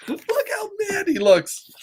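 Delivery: very high, whiny little voice; squeaky repeat. Acted. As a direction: squeal it in a playful put-on voice.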